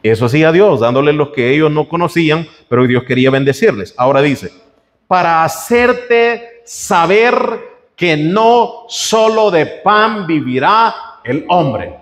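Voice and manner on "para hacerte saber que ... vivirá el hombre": very slow, weighty scripture reading; slow solemn reading. Acted. Preacher reading scripture to the congregation, slow and solemn. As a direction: give every word of the verse its own weight. Preacher reads verse very slowly.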